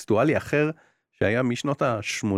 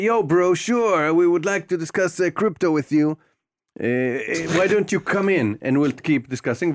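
high, very slow, drawn-out bro voice; chummy crypto bro; 5 semitones higher, much slower than usual. Retold or imitated. Game character inviting the player in, drawn-out bro voice. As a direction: stretch every word like a laid-back crypto dude. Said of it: "הוא אומר משהו".

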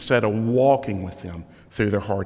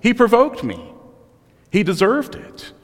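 whiny excuse; 9 semitones higher, 3 dB louder than usual. Retold or imitated. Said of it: "every murderer is gonna have a justifiable excuse".

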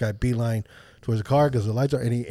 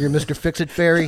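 laughing through a mocking jab, higher and louder; mocking the stranger while laughing, louder; laughing mockery; 5 semitones higher, 6 dB louder than usual. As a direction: sneer it out while cracking up.

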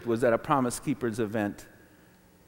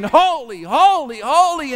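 high, raised, insistent refrain; louder worship refrain; 7 semitones higher, 4 dB louder than usual. Retold or imitated. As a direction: call out each one in worship. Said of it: "we sang it louder and louder".

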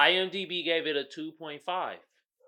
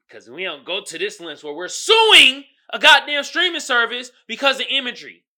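loud rant; 6 semitones higher, 11 dB louder than usual. Real, not acted. Ranting about suing streaming service.

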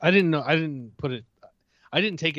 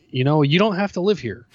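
louder, higher grumbled suggestion; retold fed-up husband, raised voice; mock exasperation; 5 semitones higher, 6 dB louder than usual. Husband retelling what he tells his wife, mock exasperated.

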